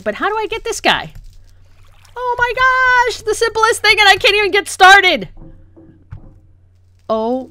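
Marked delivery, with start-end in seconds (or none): 2.2-5.3 exasperated wail. Real, not acted. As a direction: wail in frustration, pitched way up. Exasperated at failing simple task.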